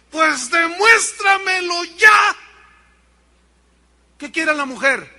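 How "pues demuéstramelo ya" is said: slow, loud, high demand; fed-up wife shouting. Acted. Fed-up wife demanding proof from husband, slow shout. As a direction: hammer each word at him.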